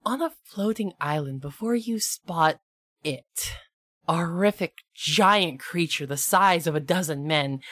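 ominous storyteller unveiling a dreaded beast; dramatic monster introduction. Acted. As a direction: build suspense with measured, portentous phrases. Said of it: "I'm gonna give y'all this introduction".

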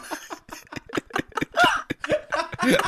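helpless squealing laugh; high cracking-up laugh. Real, not acted. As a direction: burst out laughing at your own joke. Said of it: "Nick's cracking himself up".

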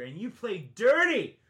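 slow, loud, wounded accusation; tearful angry shout. Real, not acted. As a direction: hurl it at him through tears. Tearful accusation of fellow actor.

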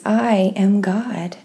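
ordinary delivery

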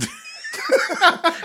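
loud, high burst of laughter. Real, not acted. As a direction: laugh hard at the punchline. Laughing at Beethovens punchline.

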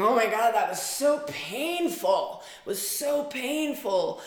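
anguished cry out. Retold or imitated. Reliving pain while meditating.

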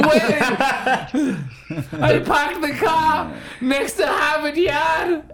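high, slow Boston voice with laughter; Boston accent. Acted. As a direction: ham up a Harvard Yard Boston drawl. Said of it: "Don't step on my impressions".

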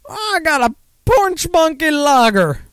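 hammed-up good ol' boy reply; mock southern drinker. Imitating a southern buddy naming his beer. Stereotyped southern friends at a bar.

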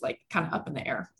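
normal talking voice

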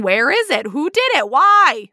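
rising frantic questions. Real, not acted. Building flood of questions.

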